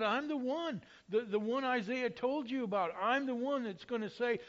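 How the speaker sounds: a preacher voicing the prophet's bold claim; John the Baptist. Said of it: "John the Baptist said".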